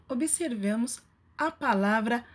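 ordinary talk

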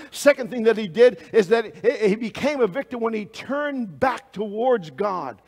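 rising sermon build to a shout; preaching at full force. Acted. Preacher driving the congregation toward a final shouted choice.

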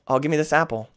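ordinary talk

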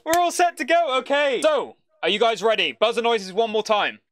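big, booming game show intro; game show host. Acted. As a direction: welcome the crowd like a TV host.

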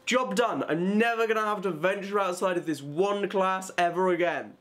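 high, self-satisfied declaration; mock triumph. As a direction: declare victory, pitched well up. Celebrates finished errand, naively sure.

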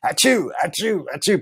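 high, quick imitation sneezes; mock sneezes. Imitating the tourists sneezing one after another, high mock sneezes. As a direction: imitate a row of people sneezing.

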